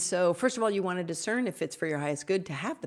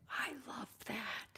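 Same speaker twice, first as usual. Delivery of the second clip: slow, hushed, awed whisper; moved whisper; 7 semitones higher, 12 dB quieter, slower than usual. Real, not acted. Reacting to a listener's Reiki question, awed whisper. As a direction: breathe it out, touched.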